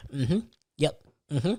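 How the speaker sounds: usual speaking voice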